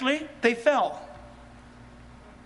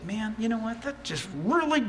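softer, grumbling churchgoer put off by the truth; annoyed grumble; 5 dB quieter than usual. Acted. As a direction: mutter your irritation under your breath. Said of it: "you can say".

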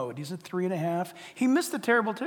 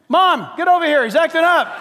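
higher, loud call across the house; 9 semitones higher, 12 dB louder than usual. Acted. Grandpa calling his wife in to see the boy, loud. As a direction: shout for the wife to come look.

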